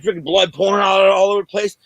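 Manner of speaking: ordinary delivery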